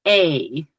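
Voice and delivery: "A" said like English letter A; Mohawk E sound. Shown as a demonstration. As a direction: say "A" clearly. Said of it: "I will do it right now".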